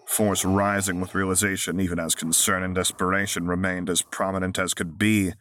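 ordinary delivery

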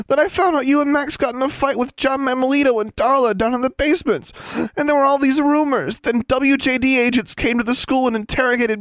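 very high, loud tearful wail; sobbing girl wails at her returned roommate. Acted. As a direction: cry it out in relief and grief. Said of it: "Anna wailed".

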